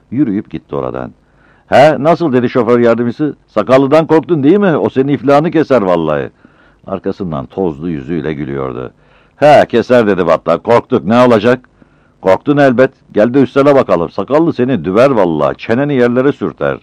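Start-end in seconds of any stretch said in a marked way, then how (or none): 1.7-6.3 loud, higher jeering; mocking taunt. Driver's mate mocks Battal. 9.4-16.8 loud, higher banter; taunting back and forth. Acted. Mocking exchange with driver's mate.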